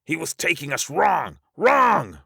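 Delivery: high cry building to a loud last word; screamed protest. Acted. Russell accusing the dead leader, rising scream. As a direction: scream the accusation, pitch soaring. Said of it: "Russell screamed".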